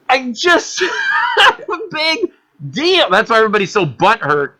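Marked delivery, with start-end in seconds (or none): 1.4-2.3 laughing shout; real burst of laughter. Real, not acted. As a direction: laugh out at the absurdity. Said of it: "laughs at own story".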